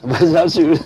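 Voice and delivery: amused repeat of the guest's line; laughing echo. Real, not acted. Host laughing as he echoes the guest's childhood detail.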